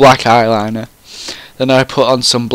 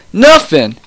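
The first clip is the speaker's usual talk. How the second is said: plain reading voice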